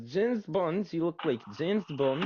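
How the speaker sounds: high-pitched mock Spanish accent; excitable Spanish-accented impression; imitating Spanish accent. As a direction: play the Spanish guy with little English, pitched high. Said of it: "Spanish guy that speaks very little English".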